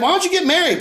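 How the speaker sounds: high prying question, mock accusing; nosy public asking. Public pressing Michael about marriage, prying mock question. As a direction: needle him like a gossiping crowd. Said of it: "everybody's like".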